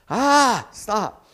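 sudden high, loud cry of stop; exasperated outburst. Real, not acted. Preacher fed up with people saying like, loud and exasperated. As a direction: burst out in real annoyance. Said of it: "my pet peeve".

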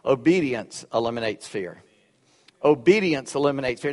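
"Obedience eliminates fear" said twice, with weight on "Obedience"; repeated key line. Shown as a demonstration. Pastor gives the church the sermon's takeaway. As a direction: drive the main point home. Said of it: "here's what I want you to take home".

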